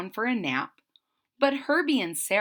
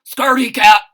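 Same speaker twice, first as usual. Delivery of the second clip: loud barked jeer; dog Hector barking at kitten; barking dog taunt; 3 semitones higher, 16 dB louder, faster than usual. A narrator's reading. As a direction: bark it like a big dog. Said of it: "barks Hector".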